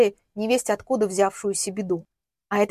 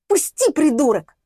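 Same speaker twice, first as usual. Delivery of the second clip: high, loud furious demand; flaring up; 5 semitones higher, 5 dB louder than usual. A narrator's reading. Woman fights off man gripping her, high angry cry. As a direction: explode at him to let go. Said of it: "взвилась она".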